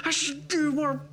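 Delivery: high, slow goofy muse; mock dopey voice. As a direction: drawl it like a dim jock. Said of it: "Yeah, like".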